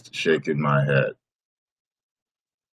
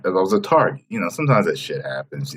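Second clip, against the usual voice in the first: ordinary talk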